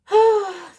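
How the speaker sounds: loud, high sigh; big sigh. Real, not acted. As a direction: let out a big sigh before wrapping up. Big sigh before closing the reading.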